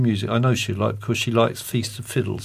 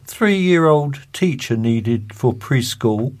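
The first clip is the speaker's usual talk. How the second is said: slow deadpan read; 3 dB louder, slower than usual. Acted. Reading joke ads aloud.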